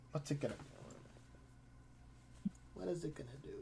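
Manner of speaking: soft, slow thinking aloud; muttering to himself. Real, not acted. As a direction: mutter it under your breath. GM musing on monster move.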